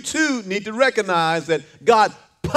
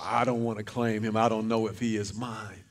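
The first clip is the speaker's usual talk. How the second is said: mocking deadbeat dad; 12 semitones lower, 11 dB quieter than usual. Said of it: "families that say".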